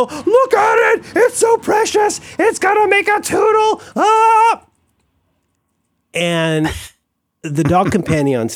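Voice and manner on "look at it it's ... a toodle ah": very high, slow squeal of delight; squealing over a dog. Retold or imitated. Retelling how he gushed at the Samoyed out the window, very high squeal. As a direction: squeal like a kid seeing a puppy. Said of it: "and I go".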